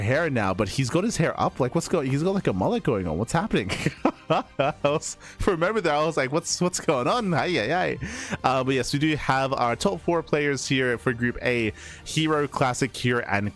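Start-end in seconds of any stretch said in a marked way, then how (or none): none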